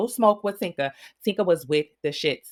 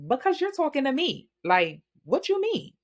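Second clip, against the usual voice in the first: high-pitched imitated comeback; high mock indignation; mock offended retort; 9 semitones higher than usual. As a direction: talk back in an affronted, raised voice. Retells Pretty P's offended retort.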